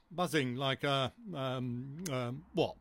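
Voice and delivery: slow, halting hesitation; searching for words. Acted. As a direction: stall while hunting for the word. Searching for the word.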